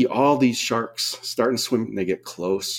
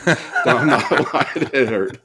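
laughing insistence; 3 semitones higher, 3 dB louder than usual. Retold or imitated. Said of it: "He goes".

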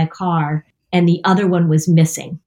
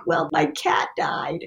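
mock sad confession; 8 semitones higher, 7 dB quieter, slower than usual. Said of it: "I'm not gonna say, like".

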